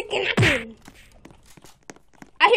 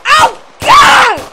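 startled yell; 7 semitones higher, 12 dB louder than usual. Real, not acted. Startled in game.

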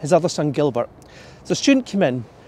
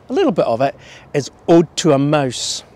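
normal talking voice

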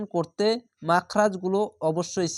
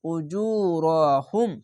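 Quranic recitation; much slower than usual. Shown as a demonstration. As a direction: chant it, holding the long vowels. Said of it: "এক আলিফ টেনে পড়বেন".

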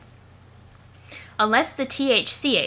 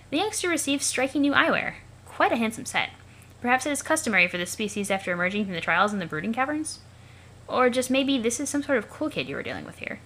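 higher-pitched, formally curious observer; alien observer narrator; 3 semitones higher than usual. Acted. Observer narrating a youngster's new eyewear, lifted formal voice. Character voice narrating what is watched.

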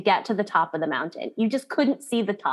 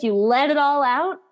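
ordinary talk, nothing marked

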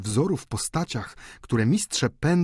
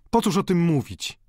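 raised, higher-pitched protest; exclaiming; 7 semitones higher, 3 dB louder than usual. A narrator's reading. Student embarrassed by talk of his sacrifice, exclaiming. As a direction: protest with a mild outcry. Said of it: "zawołał Rastignac".

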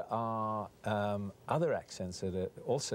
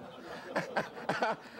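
laugh; 11 semitones higher than usual. Real, not acted. Laughing after the ad.

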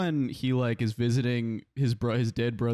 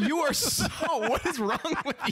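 laughing; 12 semitones higher than usual. Real, not acted. Friend's confusion cracks everyone up.

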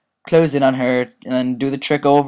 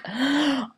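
mock gasp; 14 semitones higher, 7 dB quieter than usual. Mock shock at own joke.

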